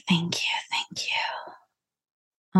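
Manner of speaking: slow, hushed whisper of gratitude; whispered thanks. Real, not acted. Host closing a prayer with thanks to God, whispered. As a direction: let the repeated thanks sink into a whisper.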